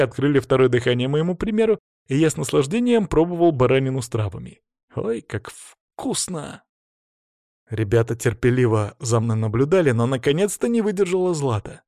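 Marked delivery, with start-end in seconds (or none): 4.9-6.6 slow, soft, high relish; drawn-out hushed delight; savouring. Acted. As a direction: linger blissfully over the taste. Savouring mutton while others wait.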